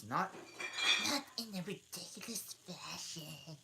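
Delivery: high, lightly squeaky creature; dormouse voice. Acted. Dormouse retorting to Alice, high and prim. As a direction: sniff it out like a prim little mouse. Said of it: "said the dormouse".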